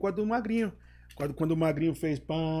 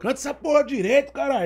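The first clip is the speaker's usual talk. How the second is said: yelling across the room; 7 semitones higher, 4 dB louder than usual. Retold or imitated. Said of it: "Ele gritava lá de dentro".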